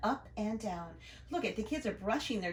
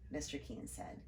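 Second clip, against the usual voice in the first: ordinary delivery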